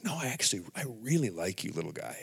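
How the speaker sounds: soft, fading gentle reassurance; tender voice of the Lord. Retold or imitated. The Lord reassuring a guilty believer, gentle and soft. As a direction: speak it softly, like a loving father. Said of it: "He's like".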